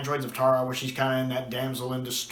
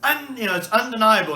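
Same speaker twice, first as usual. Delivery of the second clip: insistent princess; 8 semitones higher, 5 dB louder than usual. Retold or imitated. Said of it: "Xanxia insisting".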